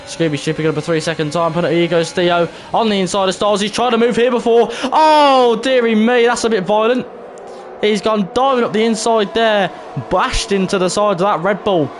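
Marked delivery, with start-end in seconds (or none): 1.8-11.9 live race commentary. Acted. Streamer calling a violent dive up the inside, excited commentary. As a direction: react to the crash as it happens, high and animated. Calls an overtake and crash.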